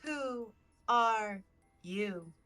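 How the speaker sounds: drawn-out, otherworldly question; slow eerie tree voice. Acted. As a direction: let each word hang, slow and eerie.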